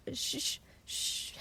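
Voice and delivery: very soft shushing; soothing hush. Acted. Annie calming upset Sam, soothing hush. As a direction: hush them gently under your breath.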